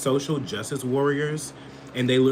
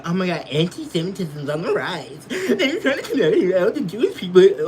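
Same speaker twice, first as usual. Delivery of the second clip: higher, louder mock panic with a laugh; mocking alarmists; 5 semitones higher, 3 dB louder than usual. Mimicking people crying about rising antisemitism, mock panic. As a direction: ham up the hysterical worrier. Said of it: "so many people who's like".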